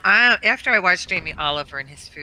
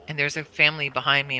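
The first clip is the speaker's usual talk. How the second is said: ordinary talk, nothing marked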